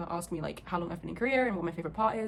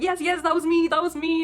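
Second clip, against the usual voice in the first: high, loud, eager retelling; excited confirmation; 8 semitones higher, 8 dB louder than usual. Retold or imitated. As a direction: act out thrilled self-identification. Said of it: "we both got so excited".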